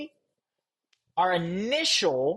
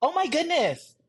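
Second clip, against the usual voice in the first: high, exclaimed no and oh my goodness; shocked disbelief; 8 semitones higher, much slower than usual. Acted. Reacting to a friend's outfit, shocked high exclaim. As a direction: react like you can't believe the outfit.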